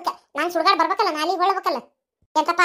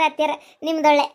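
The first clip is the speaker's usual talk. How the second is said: normal talking voice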